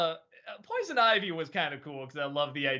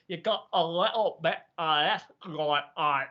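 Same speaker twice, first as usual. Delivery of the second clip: a poor Scottish accent, as if chewing round an apple; rough Scottish accent. Shown as a demonstration. Streamer showing the apple trick for accents, rough Scottish attempt. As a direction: attempt Scottish with big, rounded mouth movements. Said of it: "Imagine you're just taking really big round bites of the apple".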